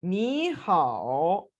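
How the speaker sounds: very slow नी हाओ with नी rising and हाओ dipping; tone sandhi ni hao. Shown as a demonstration. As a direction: draw out नी हाओ, first syllable rising. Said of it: "पहली third tone को ... second tone में convert".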